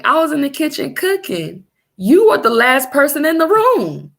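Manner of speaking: high, loud indignant protest; heated retort. Retold or imitated. Wife defending herself to the husband who blames her, indignant. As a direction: throw it back at him, exasperated. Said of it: "And I'm like".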